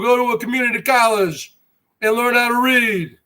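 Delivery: ordinary talk, nothing marked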